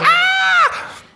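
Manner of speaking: very high, loud squeaky scream; shrieking helium yell. Retold or imitated. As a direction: scream like a balloon's gone to your head. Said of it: "the scream ... more like".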